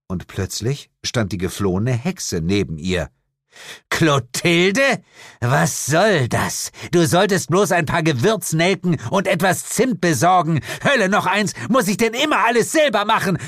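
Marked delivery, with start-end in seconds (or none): 3.9-13.4 high, loud, indignant telling-off; exasperated scolding. A narrator's reading. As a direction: scold an incompetent underling, pitch rising. Lilith scolds her returned witch. (aufgebracht schimpfen)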